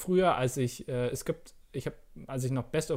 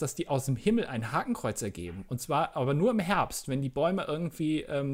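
usual speaking voice

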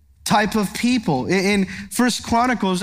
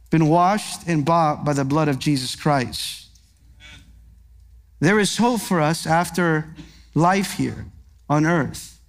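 tender comfort; 4 semitones lower than usual. Real, not acted. Preacher comforting mourners, low tender reassurance. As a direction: comfort the grieving gently.

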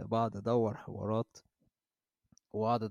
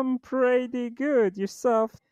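higher, slow Texan supervisor voice; changed voice; 13 semitones higher, 8 dB louder, much slower than usual. Retold or imitated. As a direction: drawl it out as the fake boss. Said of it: "مغير صوتي".

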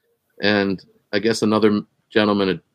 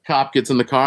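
ordinary talk

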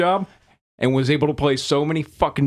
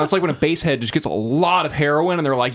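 usual speaking voice

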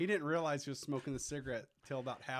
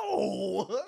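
long-drawn loud mock "no"; hammed-up cry of disbelief; horrified denial; 3 semitones higher, 7 dB louder than usual. Retold mom in loud denial.

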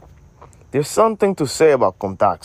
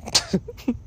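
high laugh; 12 semitones higher, 6 dB quieter than usual. Real, not acted. Laughs at slang question.

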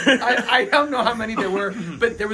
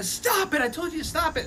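high mock scream at restrained volume; screaming sister; 9 semitones higher than usual. Sister orders brother to leave kittens alone, mock screaming. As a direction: scold in a high, held-back shriek. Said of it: "she's screaming".

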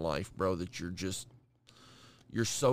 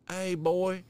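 imitating a Southern country drawl, higher; country accent; 7 semitones higher, 4 dB louder than usual. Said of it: "he was country as fuck".

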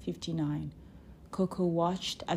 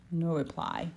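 ordinary delivery

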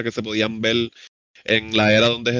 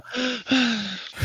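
gasp for air; 12 semitones higher, 3 dB quieter than usual. Real, not acted. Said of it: "respira, respira".

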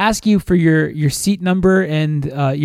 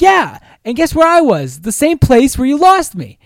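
much higher, louder mocking retort; retold sarcastic anger; 8 semitones higher, 5 dB louder than usual. Boyfriend scolding girlfriend, high and loud with sarcasm. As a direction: throw her logic back at her, fed up. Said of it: "I was, like, so pissed".

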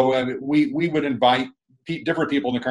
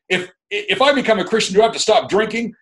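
higher, half-hammed husband's worried question; mock husband; 9 semitones higher, 5 dB louder than usual. Husband asking the preacher about giving up drinking, mock higher voice. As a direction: play the reluctant husband, pitched up. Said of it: "He said to me".

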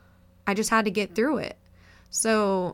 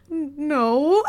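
imitated tearful no, pitched high; choked-up denial; 7 semitones higher, 5 dB louder than usual. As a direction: stammer out a high, drawn-out mock-weepy denial. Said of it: "I get choked up and I'll start crying".

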